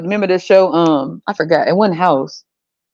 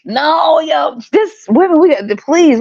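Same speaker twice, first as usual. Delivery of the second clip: exasperated; 7 semitones higher, slower than usual. Real, not acted. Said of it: "exasperated outburst".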